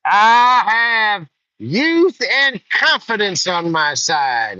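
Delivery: very high, loud, slow cocky boast; albino kid's boast. Retold or imitated. Albino kid boasting to Jude Law before drawing, very high and loud. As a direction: stretch it out, full of swagger. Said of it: "he says".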